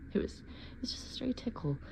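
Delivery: whispered denial; whisper, convincing yourself. Acted.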